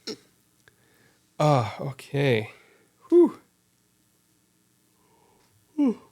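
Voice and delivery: yawning through the words. Real, not acted. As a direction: talk mid-yawn. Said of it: "all this yawning".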